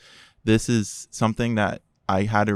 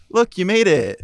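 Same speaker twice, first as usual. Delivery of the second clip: higher, louder, flippant tone; mocking brother; 10 semitones higher, 7 dB louder than usual. Brother greeting him at the door, higher and flippant. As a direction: make light of it. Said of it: "He goes".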